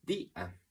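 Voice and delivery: día broken into dí, then a; two syllables. Shown as a demonstration. Teacher counting two syllables, día split as dí-a. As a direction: leave a gap inside día after the í. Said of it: "palabra lleva dos sílabas".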